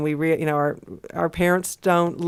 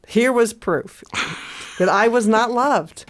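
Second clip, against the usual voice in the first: higher, melodramatic declaration; hammed-up woe; mock tragic; 5 semitones higher than usual. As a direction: declare it like a wronged child, for laughs. Mock tragedy of childhood slight.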